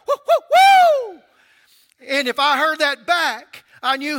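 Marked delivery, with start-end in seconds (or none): 0.1-1.2 very high, very loud whooping call; calling holler. Retold or imitated. Calling his friend out to play, very high loud whoop. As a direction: whoop it out the door to a friend down the street. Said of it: "step out my door and go".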